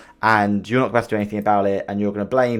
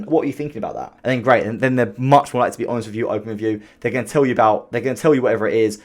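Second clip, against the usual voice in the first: normal talking voice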